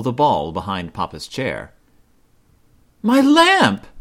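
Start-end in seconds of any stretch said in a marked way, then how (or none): 3.0-3.9 high, loud shocked gasp of a word; dismayed cry. A narrator's reading. Mama finds her best lamp broken, loud dismay.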